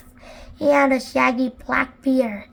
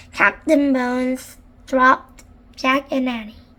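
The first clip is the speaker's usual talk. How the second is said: usual speaking voice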